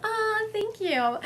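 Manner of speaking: high, drawn-out cooing "aw"; sweetly grateful, with a little laugh; touched thanks. Real, not acted. Touched by the sitter's confirmation.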